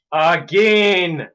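loud, frustrated cry; exasperated shout. Real, not acted. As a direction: shout it out in disbelief with a falling end.

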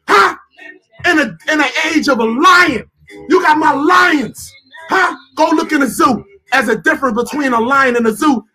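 impassioned rant. Real, not acted. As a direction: push it out hard, punching the key words. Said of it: "when a brother speak like this, he angry".

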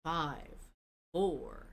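slow booming fighting game announcer; Super Smash Brothers announcer. Acted. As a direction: announce it like a game's countdown. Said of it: "my best, um, Super Smash Brothers voice".

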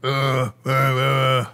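put-on gruff old-man grunting; deep grunt demo. Shown as a demonstration. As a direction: show a deepened, gruff groan. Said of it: "you need to deepen".

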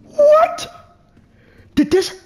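shrill startled yelp; high-pitched startled what. Real, not acted. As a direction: jump and squeal it. Startled in horror game.

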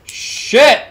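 sharp loud curse; frustrated yell. Real, not acted. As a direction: snap it out in frustration. Game death outburst.

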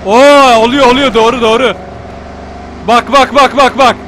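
loud, excited repeated exclamations; excited discovery. Real, not acted. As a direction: burst out as it finally works. Drift suddenly works.

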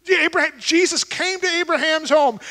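normal talking voice

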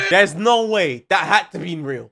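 usual speaking voice

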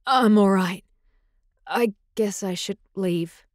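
slow, slightly hoarse, halting voice; a hint of hoarseness, slow and halting; hoarse mutter. A narrator's reading. Chilled alpha moving to leave, hoarse mutter. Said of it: "he muttered hoarsely".

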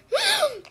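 squeaky giggle, very high; high-pitched laugh. Real, not acted. After sour candy challenge.

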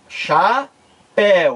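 CHAPÉU split slowly into cha and péu with a pause; teacher's slow split of CHAPÉU; syllable by syllable. Shown as a demonstration. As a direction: draw out each syllable of CHAPÉU. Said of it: "Vamos fazer a separação silábica".

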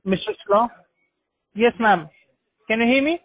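usual speaking voice

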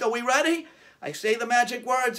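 ordinary talk

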